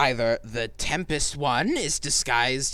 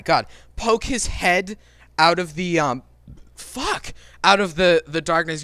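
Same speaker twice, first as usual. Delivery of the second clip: ordinary delivery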